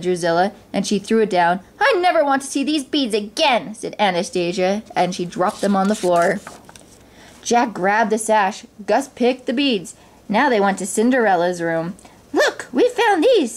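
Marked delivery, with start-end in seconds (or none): 1.8-3.7 higher-pitched huffy refusal; petulant stepsister. A narrator's reading. Anastasia rejects the beads. 12.3-13.5 high, stretched-out squeak; excited mouse. A narrator's reading. Gus shows off found trimmings.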